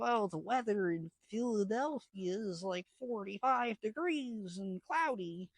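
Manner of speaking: high-pitched put-on attendant singsong; mock cabin announcement. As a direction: imitate an attendant reading out the weather. Gloomy homecoming weather announcement imitated.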